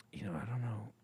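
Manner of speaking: barely voiced, under the breath; whispered aside. Real, not acted. Streamer mutters doubt to his chat, whispered. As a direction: drop to a whisper, as if to yourself.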